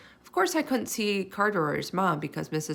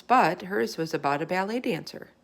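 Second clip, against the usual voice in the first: ordinary delivery, nothing marked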